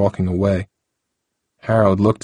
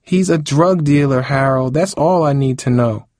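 higher, insistent rebuke; mildly angry, raised-pitch retort; firm rebuke; 7 semitones higher than usual. Acted. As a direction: shut him down firmly. Cindy argues back at Harold.

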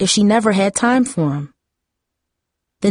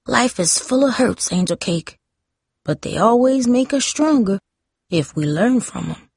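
Grandma's words; 4 semitones higher than usual. Retold or imitated. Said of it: "Like Grandma said".

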